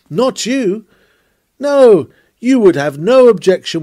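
high-pitched scornful accusation; accusing worldling. Acted. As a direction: throw the charge back at the church with pointed emphasis. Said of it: "What then do worldlings say".

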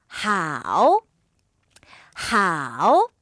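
long 하오 sinking low, then rising; third-tone demonstration. Shown as a demonstration. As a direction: dip 하오 to the bottom and bring it up slowly. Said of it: "삼성이에요". (하오를 낮게 찍고 올리는 3성으로)

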